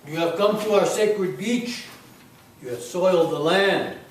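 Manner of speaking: loud, booming ancient guardian warning; solemn island guardian. Acted. Guardian of the sacred beach warns intruder, loud and solemn. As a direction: thunder it out like a temple keeper.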